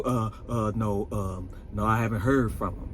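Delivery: low, soft mumbled denial; evasive Drew. Retold or imitated. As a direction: play dumb in a low, hushed voice.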